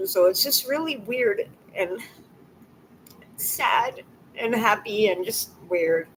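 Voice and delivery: trying to steady the voice; tearful. Real, not acted. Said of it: "I don't know why I'm crying".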